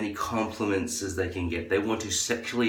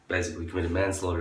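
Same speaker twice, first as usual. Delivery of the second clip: ordinary talk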